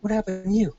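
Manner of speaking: high-pitched, baffled question; retold disbelief at an injured soldier; incredulous demand. Sergeant asks the next limping soldier.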